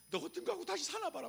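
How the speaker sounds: high, heated spouse threat; mock quarrel. Preacher acting a spouse mid-fight, high mock-angry threat. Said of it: "막 이러면서".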